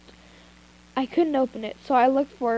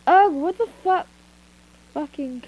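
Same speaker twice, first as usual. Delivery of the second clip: annoyed; 3 semitones higher, 4 dB louder than usual. Acted. Character waking up annoyed.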